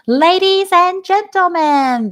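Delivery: high, loud, slow booming announcement; grand announcer. A narrator's reading. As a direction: boom it out to the whole grandstand. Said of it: "the loudspeaker said".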